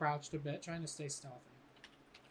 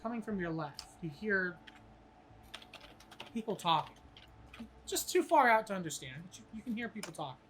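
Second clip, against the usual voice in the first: game master scene setting; 6 dB louder than usual. Acted. GM sets the dream scene.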